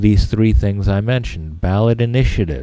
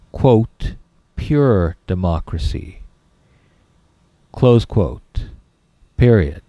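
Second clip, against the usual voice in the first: dictating. Shown as a demonstration. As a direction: read it out for students to copy. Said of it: "you need to write".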